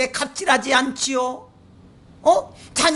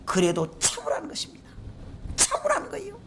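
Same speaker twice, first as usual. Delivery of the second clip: soft, near-whispered urging; hushed exhortation; 9 semitones higher, 10 dB quieter than usual. Acted. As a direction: drop to a hush to urge patience. Softened exhortation to endure. (조용히 속삭이듯 권면)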